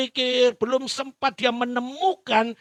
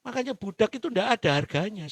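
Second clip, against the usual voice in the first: ordinary talk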